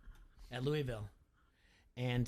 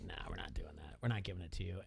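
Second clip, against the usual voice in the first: low, soft retold brush-off; understated, lower-pitched refusal; offhand refusal; 8 semitones lower, 5 dB quieter, faster than usual. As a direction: quietly act out turning someone down. Acting out teammate's refusal.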